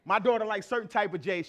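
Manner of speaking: ordinary delivery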